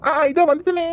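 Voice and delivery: high call of coming right away; a high, eager shout back. Acted. Answering a call from afar.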